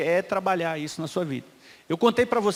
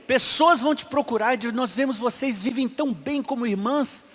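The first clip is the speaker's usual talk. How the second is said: louder preaching voice, rising with fervour; forceful preaching; 2 semitones higher, 5 dB louder than usual. Acted. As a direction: preach it out to the congregation. Pregação em voz forte. (pregação fervorosa, mais alta)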